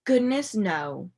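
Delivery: ordinary delivery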